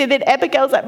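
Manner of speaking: usual speaking voice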